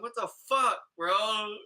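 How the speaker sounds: dusting off and demanding an answer; indignant what the fuck. Retold or imitated. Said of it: "being like".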